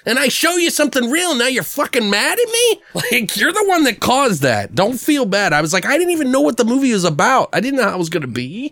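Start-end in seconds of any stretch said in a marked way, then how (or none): 0.1-5.5 high-pitched indignant argument; worked-up comeback, easing down in pitch at the end; retold angry retort. Retold quarrel, his retort. 5.8-8.7 quick, high-pitched excuse; hurried defensive whine, pitch dropping toward the end; retold protesting defence.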